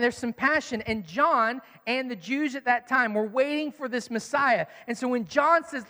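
normal talking voice

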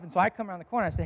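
ordinary talk

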